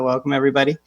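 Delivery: ordinary talk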